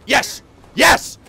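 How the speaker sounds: high, loud cheer; triumphant. Real, not acted. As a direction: yell in relief. Escaped into cottage.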